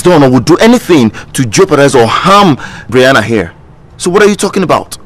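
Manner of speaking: loud, indignant rebuttal; angry retort. Acted. Man angrily defends Angela against Bob's accusation. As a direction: hit back hard at the accusation.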